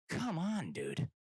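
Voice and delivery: high, pleading disbelief; incredulous protest; exasperated. Retold or imitated. Exasperated appeal to driver.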